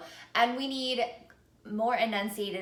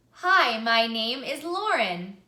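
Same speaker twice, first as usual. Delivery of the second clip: louder, slower greeting from the lungs; speak louder; 6 dB louder, slower than usual. Shown as a demonstration. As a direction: fill your rib cage and speak it out. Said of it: "let's try to speak that a little bit louder".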